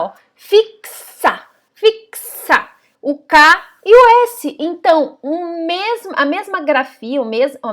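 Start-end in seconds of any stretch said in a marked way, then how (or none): 0.3-2.7 "Fixa" with the x said as a clear k then s. Shown as a demonstration. Teacher contrasting "fixa" with the Z sound. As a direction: say "fixa" twice, crisp ks. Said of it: "outra forma de falar".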